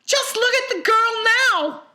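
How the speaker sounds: very high, loud retort; indignant cry. A narrator's reading. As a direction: cry out at Wonka in reproach. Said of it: "cried Missus Beauregard".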